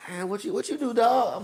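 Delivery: a hint of an impressed youngster; lightly voiced young admirer. Voicing young admirers' question.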